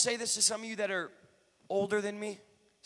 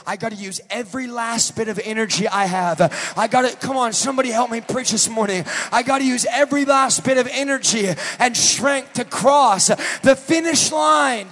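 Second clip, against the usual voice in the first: louder, rising preacher's cry; building preaching; 4 semitones higher, 9 dB louder than usual. Acted. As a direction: push it like the last mile of a race. Preacher ramps up on marathon.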